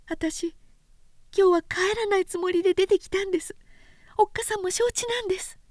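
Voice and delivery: put-on a shy young woman welcoming him home; young woman's greeting. A narrator's reading. Oyuki greeting Sōshichi at home, gentle and high.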